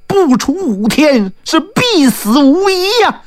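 high-pitched, gloating threat; gloating villain. A narrator's reading. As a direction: pronounce doom on the enemy in a high, triumphant voice. Taoist threatens the enemy's death.

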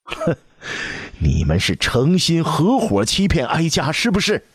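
scornful snort, then high accusing question; enraged empress accusing them of deceiving her; furious accusation. A narrator's reading. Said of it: "太皇太后闻言怒极反问".

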